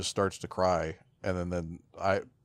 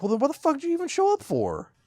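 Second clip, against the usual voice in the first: much higher, louder and fast, fed up; exasperated outburst; 6 dB louder, faster than usual. Retold or imitated. Mocking Swamp Thing's exit to the cohost, exasperated and much higher. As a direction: fire it off in a fed-up squeal. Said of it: "But it was like".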